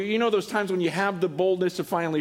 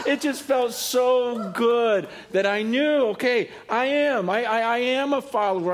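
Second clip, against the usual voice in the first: excited; 7 semitones higher, 3 dB louder than usual. Retold or imitated. Excited lady retelling her joy.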